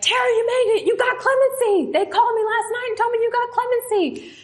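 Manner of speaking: retelling her mom's overjoyed high voice as she breaks the good news; high thrilled mother's voice, near shouting; excited mom. Retold or imitated. Mother tells her daughter she got clemency, thrilled high voice.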